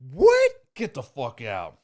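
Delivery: shrieked high, loud "What?" in disbelief; shocked customer. Retold or imitated. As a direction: gasp then a shout of disbelief. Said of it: "And they're like".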